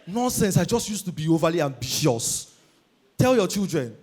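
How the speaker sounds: usual speaking voice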